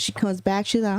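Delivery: ordinary delivery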